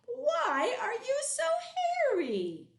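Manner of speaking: a silly little monster asking; squeaky curious monster; high monster voice. Acted. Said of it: "The yellow one said".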